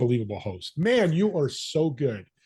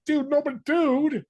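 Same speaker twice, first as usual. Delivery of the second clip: much higher, imitating the laid-back surfer; mock surfer arguing, pitched way up; surfer dude protest; 13 semitones higher than usual. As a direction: play the surfer dude, squeaking up in disbelief. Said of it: "he started arguing with me and laughing like".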